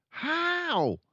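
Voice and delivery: put-on very high, eager boy voice; fascinated boy. Michael asking his father, fascinated boy voice. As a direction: ask breathless with interest. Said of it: "young Michael asked, fascinated".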